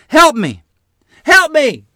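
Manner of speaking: high, loud screams for help; screaming. A narrator's reading. Injured mother screams from the barn for help. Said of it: "I heard Mother screaming".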